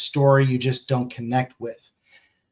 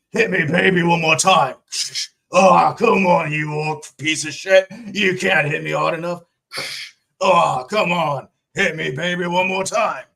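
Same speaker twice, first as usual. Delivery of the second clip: mock battle taunt; 3 semitones higher, 3 dB louder than usual. Orc battle parody of [name].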